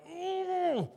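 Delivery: high, strained frustrated groan; exasperated groan. Retold or imitated. Dad fed up with daughter's stalling, high frustrated groan. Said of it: "I can feel it".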